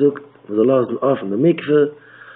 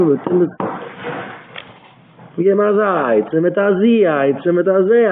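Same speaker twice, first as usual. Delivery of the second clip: fervent preaching; 5 semitones higher than usual. Acted. Preacher telling the congregation of the Maggid, fervent. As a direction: preach the tale with fire.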